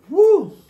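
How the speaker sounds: high, loud cheering woo; excited woo. Real, not acted. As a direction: let out a big happy whoop. Cheer after shout-out.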